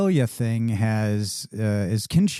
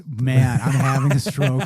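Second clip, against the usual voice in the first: ordinary talk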